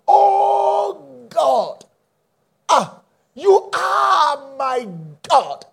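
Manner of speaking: high, loud, long-held "Oh" and "are"; preacher crying out, each key word drawn out; deliberate emphasis. Real, not acted. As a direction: stretch "Oh God" and "are" into a fervent cry. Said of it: "I'm deliberate emphasizing it".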